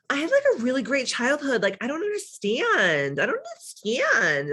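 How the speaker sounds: a mock-bewildered client voice; imitating clients. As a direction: mimic a puzzled client. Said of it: "women were like".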